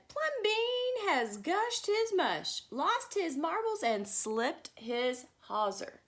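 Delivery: high, slow shocked gossip; scandalised neighbours. A narrator's reading. The neighbours gossip that Plumbean has lost his marbles. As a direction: stretch each phrase in outraged disbelief. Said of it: "the people said".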